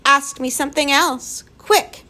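high and urgent, rising sharply on quick; girl fiercely begging for distraction. A narrator's reading. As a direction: demand it fiercely to keep from crying. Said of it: "said fiercely".

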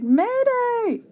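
high, loud squawked alarm; long high-pitched distress call; bird's cry. A narrator's reading. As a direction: cry out like a panicked bird, pitch leaping up. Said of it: "The wiffle bird cried".

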